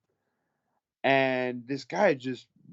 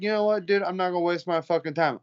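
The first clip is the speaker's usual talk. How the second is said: higher, exasperated retold retort; fed-up dismissal; 6 semitones higher, faster than usual. Bouncer done with a drunk troublemaker, fed up. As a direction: brush him off, pitched up and impatient.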